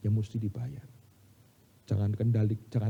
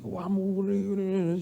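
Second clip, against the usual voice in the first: retold cursing; 8 semitones higher than usual. Gunman cursing the preacher at the supermarket, mock cursing. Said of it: "Dimaki-maki".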